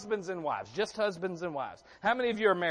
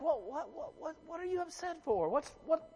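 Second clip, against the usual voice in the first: baffled groom; 9 semitones higher, 3 dB quieter than usual. Retold or imitated. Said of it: "I'm like".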